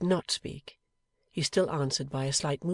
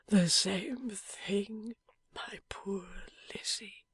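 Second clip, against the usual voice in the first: slow, breathless whisper of a wounded man; dying man's whisper; 6 semitones higher, 4 dB quieter, much slower than usual. A narrator's reading. Wounded husband whispering to his wife, faint and slow. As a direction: barely get the words out, fading.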